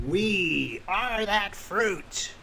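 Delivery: very slow, high, drawn-out voice; otherworldly creature intoning slowly; speaking plant creature. Acted. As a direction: stretch each word into an eerie wail. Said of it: "Another piece ... holding the body says".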